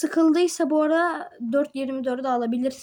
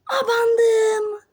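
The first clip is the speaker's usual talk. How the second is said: excited cry; 8 semitones higher, 3 dB louder than usual. Real, not acted. Cries out as jump succeeds.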